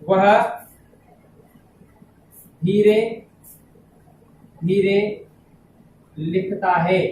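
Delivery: word by word, long pauses between words; slow dictation. Shown as a demonstration. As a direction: dictate it slowly for students to write down. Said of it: "जैसे". (धीमे-धीमे, शब्द-दर-शब्द लिखवाते हुए)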